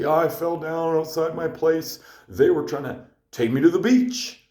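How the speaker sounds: light impression of a befuddled stranger; lightly voiced frail old man. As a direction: mildly imitate the drunk stranger. Voicing the frail drunk man.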